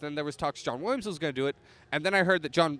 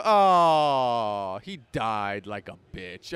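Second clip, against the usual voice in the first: long loud "oh", then slow, low jeer; drawn-out mocking jeer that drops low; imitated mockery; 5 semitones lower, 6 dB louder, much slower than usual. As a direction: mimic a heckler gloating, drawing it out. Mimics the mocking moviegoer.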